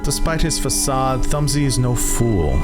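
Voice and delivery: ordinary delivery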